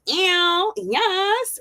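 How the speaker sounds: high-pitched celebratory squeal; playful celebration. Pretend. Celebrates being first-generation graduate.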